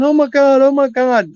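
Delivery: high, loud, thrilled cry of joy; excited shout. Retold or imitated. Sister jumping and clapping at the funeral, excited shout. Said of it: "she starts jumping and, like, in place and clapping her hands".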